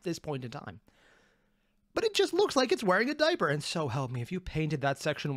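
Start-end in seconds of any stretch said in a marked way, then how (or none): 1.9-3.5 high incredulous squeal. Real, not acted. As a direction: squeal it in disbelief. Laughing at diaper mech.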